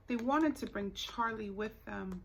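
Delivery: usual speaking voice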